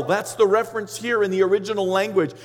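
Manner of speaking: ordinary delivery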